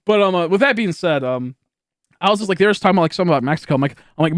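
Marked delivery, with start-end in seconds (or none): none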